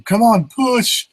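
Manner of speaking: high, strained mock labor cry; Ellie in labor. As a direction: squeal it like a mother giving birth. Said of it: "Ellie was saying to Diego".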